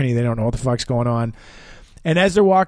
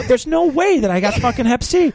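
high-pitched, emphatic denial; incredulous protest; 10 semitones higher than usual. Retold or imitated. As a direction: refuse to believe it, voice pushed up. Retold protest at diagnosis.

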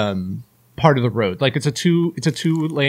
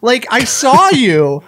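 higher, slow, exasperated and louder; mock angry retort; 9 semitones higher, 5 dB louder, slower than usual. Imitating his angry answer to the nosy neighbor, slow and exasperated. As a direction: drag it out, exasperated. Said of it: "made it even worse".

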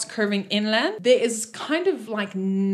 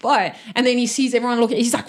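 usual speaking voice

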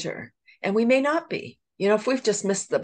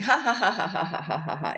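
fake laughter; 2 semitones higher than usual. Shown as a demonstration. Teaching laughter yoga, she acts out a forced fake laugh. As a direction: show everyone how a put-on laugh sounds. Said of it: "It is fake. It is put on".

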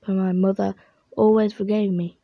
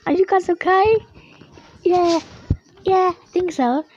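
high cartoon voices; 8 semitones higher than usual. Characters after big fight.

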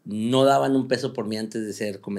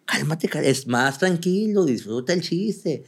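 imitating his teacher; 5 semitones higher, slower than usual. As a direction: ease the pupil down gently. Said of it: "mi maestro me decía".

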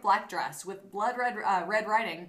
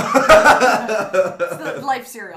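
laughing; 15 dB louder, much slower than usual. Real, not acted. Ad-style callout laughing.

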